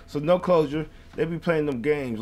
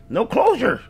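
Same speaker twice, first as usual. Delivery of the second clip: higher, louder cry of no closure; angry outburst; 9 semitones higher, 7 dB louder than usual. Real, not acted. Vlogger venting at a verdict, loud and high.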